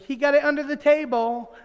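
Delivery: high, loud tattletale mimicry; tattling sister. Daughter reporting her brother's gum to mom, tattling. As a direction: imitate a bossy girl telling on her brother.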